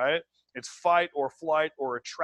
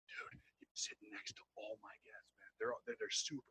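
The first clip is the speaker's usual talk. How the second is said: very hushed, confiding brag; secretive whisper; 6 semitones lower, 19 dB quieter than usual. Acted. Recruit to the next person about his guests, whispering. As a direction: whisper it to the person beside you.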